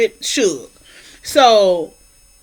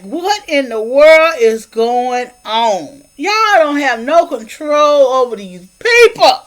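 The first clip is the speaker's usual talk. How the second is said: slow disbelief; slower than usual. Retold or imitated. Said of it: "the grandpa looking like".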